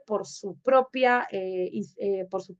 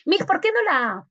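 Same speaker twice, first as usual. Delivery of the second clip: higher-pitched student piping up; a hint of a puzzled pupil, pitched up; imitated student question; 8 semitones higher, 4 dB louder than usual. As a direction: lightly voice a student questioning the teacher. Teacher voices a student's objection.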